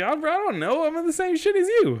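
loud, very high-pitched retort; mock-indignant, voice shooting up; exasperated protest. Telling stranger they're in the same line, high exasperation.

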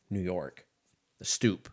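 ordinary talk, nothing marked

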